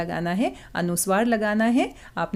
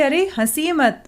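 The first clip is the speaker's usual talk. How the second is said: ordinary talk, nothing marked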